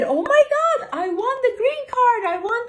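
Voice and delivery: very high, loud scream of joy; excited screaming. Retold or imitated. Au pair shouting her lottery win through the house, screaming. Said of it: "So I started screaming".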